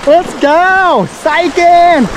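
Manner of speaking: excited. Real, not acted. Running through water, laughing.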